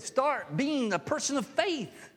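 Jesus pleading with Thomas, impassioned cry; impassioned plea. Retold or imitated. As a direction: cry out to him to come back. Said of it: "that's really what he said".

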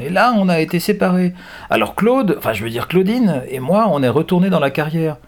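put-on high young girl's voice. A narrator's reading. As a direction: explain it as a girl to her captors. Young girl explains to captors.

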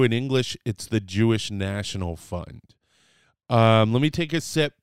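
ordinary talk, nothing marked

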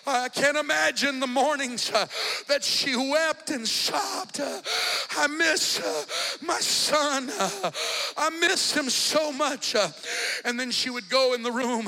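loud, rising preacher's cadence with gasped uhs; preaching at full force. Acted. Preacher building the congregation to a loud climax. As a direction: preach it hard, each phrase driven up.

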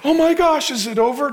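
high-pitched mock alarm; mock panic. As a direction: fret like a worried churchgoer. Voices panicking Christians in his sermon.

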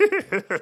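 short high chuckle; small bubbling laugh. A narrator's reading. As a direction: let out a little tittering chuckle. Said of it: "and then he chuckled".

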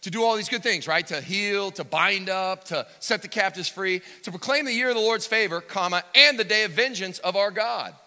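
loud, high, climbing pulpit climax; preaching at full force. Acted.

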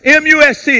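M U S T spelled out, each letter loud and high. Shown as a demonstration. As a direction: hammer every letter of M U S T. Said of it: "spells it out".

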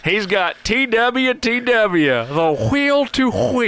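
high, slow hammed-up hype; mock sports hype. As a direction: sell it like a cheesy sportscaster.

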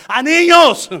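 sudden high, booming exclamation; preacher's emphatic yell; shouted outcry. Real, not acted. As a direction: burst out loud for the congregation.

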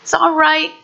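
put-on calm a hurting little boy; soothing toddler. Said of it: "we had to keep telling Owen".

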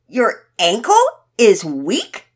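slow, loud, incredulous query; disbelieving question. A narrator's reading. Pearson doubting Todd's ankle excuse, disbelieving. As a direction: repeat it back, drawn out in disbelief. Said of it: "a bit in disbelief".

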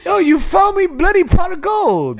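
high, drawn-out Irish leprechaun voice; slow, squealed stage-Irish; mock Irish accent. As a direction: cry it out in a hammed-up Irish accent. Leprechaun bit after Irish, gingers talk.

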